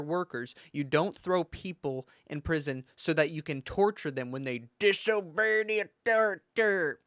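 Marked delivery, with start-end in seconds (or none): 4.8-7.0 hammed-up authority impression; mock authority figure. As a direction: exaggerate each word in a put-on voice.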